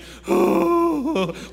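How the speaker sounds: put-on loud, high, long groaning sigh of a bored kid; exaggerated kid sigh. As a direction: heave a dramatic sigh of boredom.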